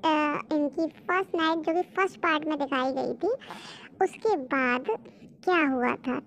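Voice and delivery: walk the listeners through the plot calmly; story narration. A narrator's reading. Narrator explains the flashback. (कहानी सुनाती हुई धीमी, नीची आवाज़)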